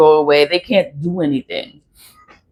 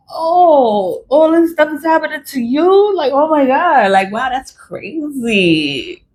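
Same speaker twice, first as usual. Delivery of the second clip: higher, feigned shock, fading slow at the end; mock surprise; 7 semitones higher, slower than usual. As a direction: play innocent amazement at their trouble. Mock surprise at their troubles.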